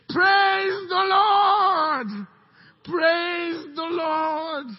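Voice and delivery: slow, loud, high praise cry; drawn-out shout. Acted. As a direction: stretch it out like a battle hymn.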